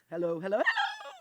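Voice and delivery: strained voice cracking upward; squeaky voice break. Shown as a demonstration. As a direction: voice cracks to a sudden squeak on the last hello. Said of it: "Watch this".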